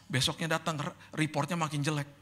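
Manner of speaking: ordinary delivery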